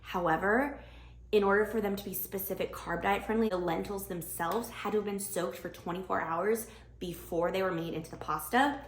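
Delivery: normal talking voice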